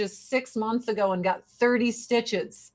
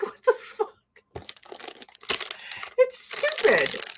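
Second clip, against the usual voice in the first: exasperated; 12 semitones higher, 5 dB quieter than usual. Real, not acted. Scolding people blocking federal agents, high exasperated. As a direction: stretch it in disbelief.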